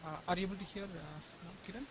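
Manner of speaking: very faint, distant voice; barely audible. Real, not acted. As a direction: speak barely audible, as if far off. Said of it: "Yeah, but it's pretty faint".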